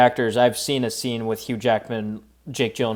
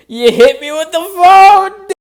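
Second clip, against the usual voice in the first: high, slow, yelled line; shouted quote; 12 dB louder, slower than usual. Retold or imitated. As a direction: yell it like a stunned victim. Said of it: "Stu just yells".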